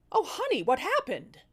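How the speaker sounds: high, fake-sympathetic coo; mock concern. Wife cooing at her husband with mock concern, high.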